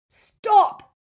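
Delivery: very loud, high-pitched cry; shout. A narrator's reading. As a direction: desperately shout to halt an attack. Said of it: "Malfoy shouted at Crabbe".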